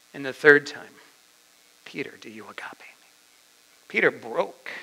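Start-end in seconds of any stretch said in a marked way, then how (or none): 1.8-3.1 very soft, near-whispered asking; hushed question. Acted. Jesus asks Peter a third time about love, hushed. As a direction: ask it gently, almost under the breath.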